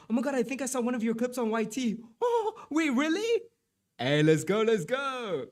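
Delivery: high and fast, thrilled at the news; excited. Real, not acted. Streamer thrilled to see viewer's clips, high excited voice. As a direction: gush at the viewer like a fan.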